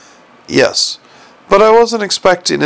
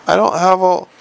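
ordinary delivery

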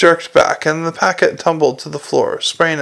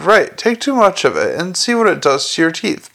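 usual speaking voice

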